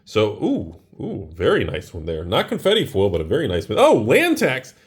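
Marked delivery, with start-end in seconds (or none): 3.8-4.7 sudden thrilled outburst at a pull; excited. Real, not acted. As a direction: blurt it out, delighted. Pulls valuable card in pack.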